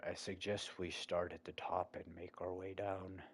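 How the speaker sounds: ordinary talk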